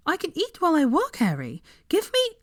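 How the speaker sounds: high, pushy protest; protesting Hermione. Acted. Hermione arguing with Harry over her quill, high protest. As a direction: insist on working, voice up high.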